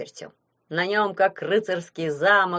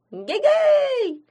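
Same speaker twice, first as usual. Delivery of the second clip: high, drawn-out squeaky call; calling halloo; 6 dB louder than usual. A narrator's reading. Imps hallooing between rooms, high drawn-out shout. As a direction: call it out loud, holding the final syllable.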